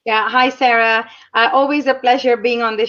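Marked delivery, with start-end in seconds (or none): none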